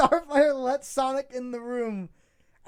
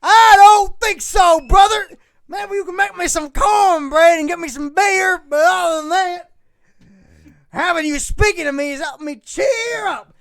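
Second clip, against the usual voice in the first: hillbilly voice; 2 semitones higher, 8 dB louder than usual. Acted. Starfire thanks Sonic in a loud Southern hillbilly voice. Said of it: "the hillbilly voice".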